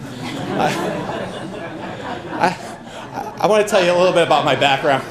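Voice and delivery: real laughter spilling into the words, a little fast; laughing through words. Real, not acted. Candidate laughing at his own joke to the crowd, laughing. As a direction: chuckle while pushing on with the speech.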